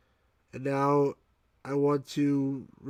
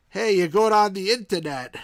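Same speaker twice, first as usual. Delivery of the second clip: imitating a Brooklyn mom, louder and higher; Brooklyn mom accent; 5 semitones higher, 7 dB louder than usual. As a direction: call it out like a Brooklyn mother, hammed up. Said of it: "like, a real Brooklyn accent".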